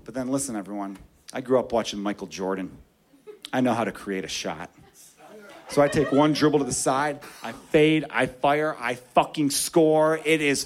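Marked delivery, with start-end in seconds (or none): none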